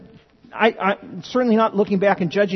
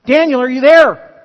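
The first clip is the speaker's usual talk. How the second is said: calling out; 6 semitones higher, 12 dB louder than usual. Acted. King calling into lion's den.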